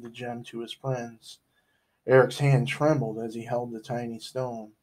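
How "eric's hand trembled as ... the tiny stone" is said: ordinary delivery, nothing marked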